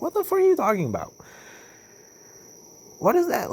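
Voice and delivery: very high, exasperated disbelief; acted-out disbelief, pitch shooting up; incredulous. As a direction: squeal the question in mock bafflement. Acts out disbelief at people's remarks.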